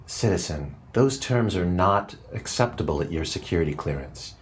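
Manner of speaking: low, cold, official AI voice; cold computer voice. Acted. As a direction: speak like a stern supercomputer.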